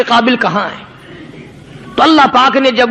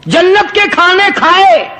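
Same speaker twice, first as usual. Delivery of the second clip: raised, cheeky retort; dog talking back; 6 semitones higher than usual. Retold or imitated. Dog rebuking the saint in a high, indignant voice. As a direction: answer back like a bold dog. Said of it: "کتے کو بھی زبان دی".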